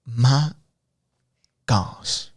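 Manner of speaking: slowly savored, exaggerated exclamation; a guessed-at woman's voice, drawn out with pauses; pretend Cynthia. Host voicing a viewer's comment, pretend voice. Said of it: "I like to pretend".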